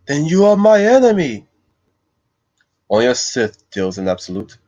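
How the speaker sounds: lightly voiced Jedi master; a hint of Obi-Wan. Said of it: "I did my Obi-Wan voice".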